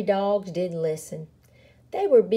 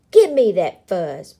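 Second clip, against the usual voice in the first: angry demand; 9 dB louder than usual. Acted. Angry prairie dogs fighting.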